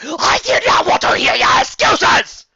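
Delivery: roaring high and loud; furious shout. Acted. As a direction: bellow at him in rage. Dark lord's outburst.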